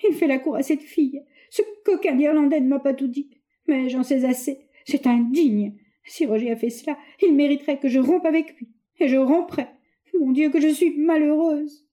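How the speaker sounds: high, tearful outpouring, slowing at the end; a young woman weeping alone over her fiancé; tearful lament. A narrator's reading. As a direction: let the grief spill over. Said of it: "Frédéric pleura à chaudes larmes".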